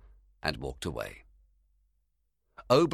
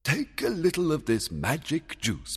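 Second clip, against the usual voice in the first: commanding; 10 semitones higher than usual. Acted. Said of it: "the king ordered".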